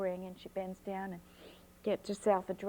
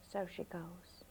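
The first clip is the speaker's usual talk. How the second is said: ordinary delivery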